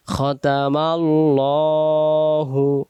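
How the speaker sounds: খতামাল্লাহু slow and clear for repeating; model recitation. Shown as a demonstration. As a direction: say খতামাল্লাহু slowly for students to echo. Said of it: "বলেন।". (শিক্ষকের মতো ধীরে বলা)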